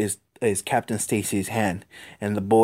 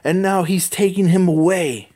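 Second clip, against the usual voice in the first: higher, louder accusing bystander; angry crowd; 7 semitones higher, 3 dB louder than usual. Acted. Bystanders below accuse Spider-Man, lightly voiced angry crowd. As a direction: voice the crowd blaming the hero, lightly. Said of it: "people in the crowd saying".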